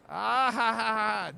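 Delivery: loud mocking ha ha ha; a burst of scornful laughter. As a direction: laugh right in his face. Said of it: "Don't you dare laugh at me".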